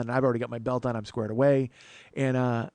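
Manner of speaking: ordinary talk, nothing marked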